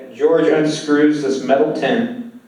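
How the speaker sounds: ordinary delivery, nothing marked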